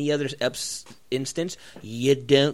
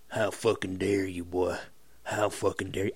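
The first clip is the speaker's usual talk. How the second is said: low, quiet mock indignation; mock outraged patriot; 7 semitones lower, 7 dB quieter than usual. Mocking an outraged fan scolding the fighter, low and quiet. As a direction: growl it like an offended old patriot. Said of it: "I wouldn't be like".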